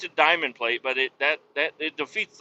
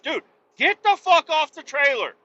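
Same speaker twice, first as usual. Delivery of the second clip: high, raised yell; retold shout; 12 semitones higher, 4 dB louder than usual. Trucker yelling at a worker climbing back on, raised high shout. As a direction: order him off, fed up.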